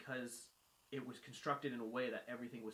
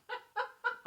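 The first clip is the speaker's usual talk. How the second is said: burst of laughter; 6 dB louder than usual. Real, not acted. Laughing at own retelling.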